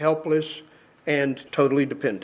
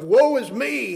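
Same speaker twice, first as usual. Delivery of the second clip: high, pitched-up cry of despair; anguished cry; 7 semitones higher than usual. Retold or imitated. Preacher voicing Isaiah's cry of woe, high and anguished. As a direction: cry it out up high as a man undone. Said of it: "I cried out".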